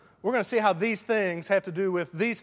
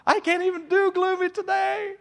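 much higher, louder, stretched-out mock cheer; high and cheery; 14 semitones higher, 7 dB louder than usual. Preacher failing to do gloomy today, high and beaming. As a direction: say it through a huge smile.

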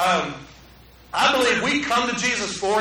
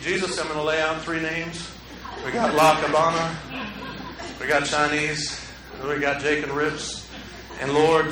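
lower, softer, half-joking prayer voice; mock prayer; 6 semitones lower, 3 dB quieter than usual. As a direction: pray over dinner plans with a hint of solemnity. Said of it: "Let's just pray".

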